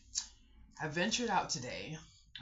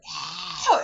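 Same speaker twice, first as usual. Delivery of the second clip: a much higher, louder cry of pain; 16 dB louder than usual. Retold or imitated. Retelling her back pain to viewers, a loud high cry. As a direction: let the hurt burst out in a high yelp.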